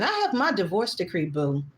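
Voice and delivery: usual speaking voice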